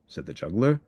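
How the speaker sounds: ordinary delivery, nothing marked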